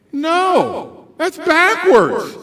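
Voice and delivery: high, drawn-out protest; emphatic objection. Real, not acted. Speaker rejects the wrong voice, emphatic protest. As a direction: cry out that it is all wrong.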